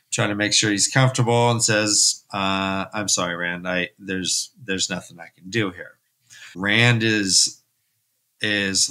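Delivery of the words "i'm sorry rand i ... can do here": put-on a healer quietly turning the boy away; quiet apology. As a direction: lower and softer, a resigned apology. Healer refusing a patient's son.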